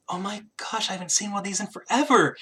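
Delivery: high, hushed amazement as the queen; gasping surprise. Acted. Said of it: "lets out a bit of a gasp".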